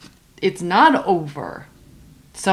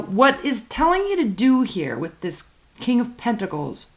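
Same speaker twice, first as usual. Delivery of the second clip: ordinary talk, nothing marked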